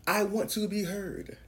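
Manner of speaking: voicing people in society. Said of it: "they say".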